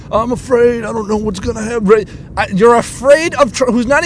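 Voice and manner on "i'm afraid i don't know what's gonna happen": slightly raised mock-scared voice; mock fearful. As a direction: mimic someone fretting, hammed-up. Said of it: "I love how afraid".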